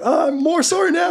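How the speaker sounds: very high cry while drifting away; falling orc's apology. Acted. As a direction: call the apology from far off, very high. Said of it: "starts falling really slowly and she's like".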